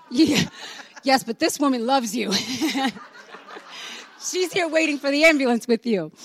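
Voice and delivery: put-on chuckling reply to a drunk's joke; laughing while talking. Laughing at drunk man's remark.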